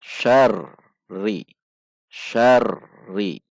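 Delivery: "Syarri" dengan r ganda ditekan keras; tekanan tasydid. Shown as a demonstration. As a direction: tekan r pada "Syarri", tahan sejenak lalu naik. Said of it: "Ada tekanan". ("Syarri" bertasydid, seolah berhenti di r)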